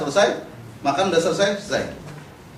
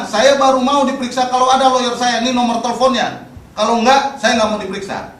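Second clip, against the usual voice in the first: loud, raised, insistent refusal; firm demand; 8 semitones higher, 8 dB louder than usual. Shown as a demonstration. Detainee demanding a lawyer before questioning, loud and firm. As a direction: lay down your terms forcefully. Models what detainees must tell police.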